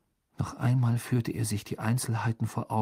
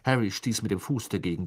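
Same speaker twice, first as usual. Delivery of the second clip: ordinary delivery, nothing marked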